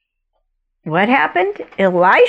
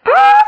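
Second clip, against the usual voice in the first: a sudden high, loud cry of dismay; the king cries out in shock at the letter; 7 dB louder than usual. Retold or imitated. King's high, loud shocked cry. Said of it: "And the king's like".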